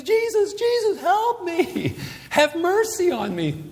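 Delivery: very high, drawn-out plea; mock yelling. Blind man begs Jesus for help, yelling. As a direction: imitate a desperate cry, slow and high. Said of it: "He starts yelling at the top of his voice".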